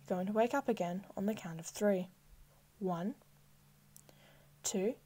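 normal talking voice

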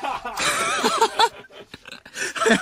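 high, helpless laughing fit; long, high cackling laugh; burst of laughter. Real, not acted. Bursts out laughing at harsh mail.

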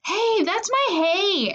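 high, drawn-out possessive whine; little sister's yell. A narrator's reading. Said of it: "Angelina yelled".

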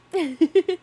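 sudden loud high cackle of laughter; loud burst of laughter. Real, not acted. Laughing at own lemon cake craving.

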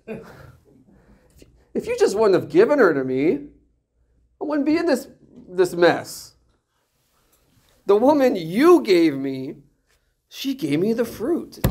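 high-pitched, hammed-up protest; mock blaming. Adam blames God for his mess, mock protesting. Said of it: "He blames God".

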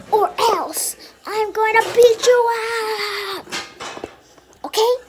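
hammed-up baby threat; mock threat. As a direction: pretend to menace the viewers like a cranky baby.

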